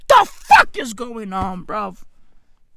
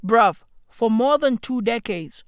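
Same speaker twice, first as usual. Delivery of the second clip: usual speaking voice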